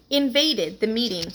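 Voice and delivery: usual speaking voice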